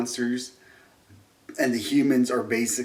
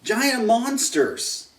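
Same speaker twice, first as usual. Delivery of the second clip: eager fan; 10 semitones higher, 3 dB louder, much slower than usual. Real, not acted.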